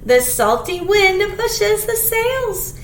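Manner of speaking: usual speaking voice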